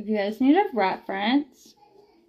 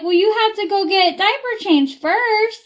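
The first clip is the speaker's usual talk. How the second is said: put-on higher, lilting voice for a toddler; sing-song baby talk; 8 semitones higher than usual. Mom explaining to her toddler, in higher sing-song baby talk. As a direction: coo it like you're talking to a baby.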